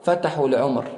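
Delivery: ordinary talk, nothing marked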